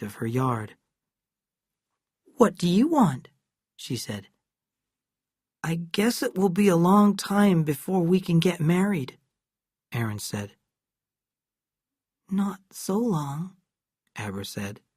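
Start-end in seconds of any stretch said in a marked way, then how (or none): 2.4-3.3 put-on lightly raised girl's voice; a hint of a curt little girl; young girl Abra. A narrator's reading. Abra voiced in read story. 5.6-9.2 lightly voiced earnest boy; a hint of a wistful little boy; young boy Erin. A narrator's reading. Erin voiced in read story. 12.3-13.6 put-on slow, soft, higher girl's voice; coy little girl, drawn out; young girl Abra. A narrator's reading. Abra voiced in read story.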